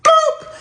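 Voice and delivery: squeaky high block sound; a sharp high-pitched boop. Retold or imitated.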